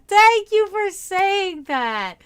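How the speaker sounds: high, loud, drawn-out thank-you; gushing thanks. Real, not acted. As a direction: gush with real gratitude, stretching the words. Reacting gratefully to a compliment.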